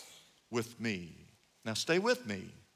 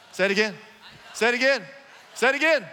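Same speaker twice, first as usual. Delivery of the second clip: usual speaking voice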